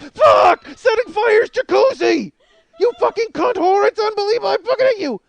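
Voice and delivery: high, loud mock screaming fit; hammed-up furious shout; enraged ranting. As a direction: scream it like a raving maniac. Mel Gibson's rant acted out.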